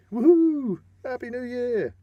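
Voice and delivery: high, loud woohoo and new year shout; mock New Year's cheer; party cheer. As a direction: cheer like it's midnight at the party.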